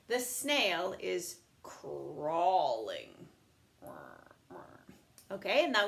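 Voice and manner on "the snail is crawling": very slow, stretched reading; slow model sentence. Shown as a demonstration. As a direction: draw out "The snail is crawling." for the class. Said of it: "So you could even say".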